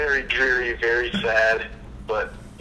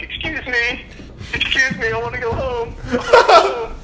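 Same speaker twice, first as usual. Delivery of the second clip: high, pleading mock whine, rising toward a laughing squeal; whiny drunk pledge; 11 semitones higher, 6 dB louder than usual. Drunk pledge begs from his jail cell, high mock whine. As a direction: beg to go home like a pathetic drunk kid. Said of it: "yelling stuff like".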